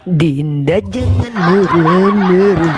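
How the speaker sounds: slow, drawn-out teasing voice; slow patronising singsong; mock gentle scolding. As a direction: talk down slowly like to a sulking kid. Slow mocking calm-down to Dinda.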